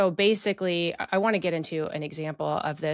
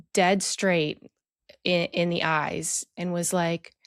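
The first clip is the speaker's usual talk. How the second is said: ordinary talk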